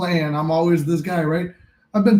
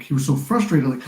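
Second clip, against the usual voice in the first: ordinary talk, nothing marked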